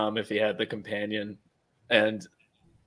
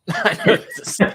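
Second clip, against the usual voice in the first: higher, laughing repeat of the joke; 8 semitones higher, 4 dB louder than usual. Real, not acted. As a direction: giggle through the line.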